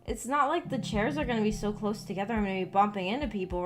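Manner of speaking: normal talking voice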